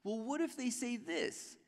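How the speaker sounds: high, whining voice; whiny Moses. Retold or imitated. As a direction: whine it like a reluctant kid. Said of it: "He keeps whining".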